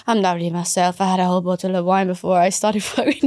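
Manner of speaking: lightly imitated Italian accent, breaking into laughter; half-hearted Italian waiter impression; mock Italian waiter. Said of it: "pretending to be an Italian waiter".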